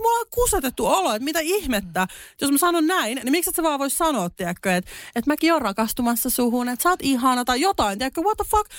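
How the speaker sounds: high-pitched, fast, indignant rant; telling a friend how furious she got in Estonia; retold outrage. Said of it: "Mä suutuin niin paljon".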